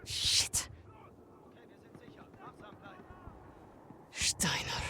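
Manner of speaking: whispered under breath; hushed whisper. Acted. Sniper hiding from the enemy, whispering his name. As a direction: breathe the name so he can't hear. Spotting enemy while hidden.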